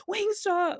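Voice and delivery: high, eager call of the name; yelling Wingstop. Retold or imitated. Girlfriend yelling Wingstop at her boyfriend, high and eager. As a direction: shout it out like a demand. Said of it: "I start yelling".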